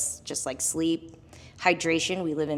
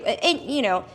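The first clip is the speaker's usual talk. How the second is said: usual speaking voice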